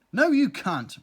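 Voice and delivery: high, loud flat denial; ranting. Acted. Earwig ranting back at the bee, loud and high. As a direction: shout it back, indignant. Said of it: "ranted the earwig".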